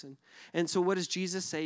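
usual speaking voice

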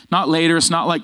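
ordinary talk

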